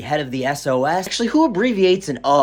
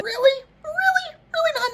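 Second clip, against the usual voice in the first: squeaky, much higher incredulous really; mock disbelief. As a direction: act stunned and disbelieving, up high.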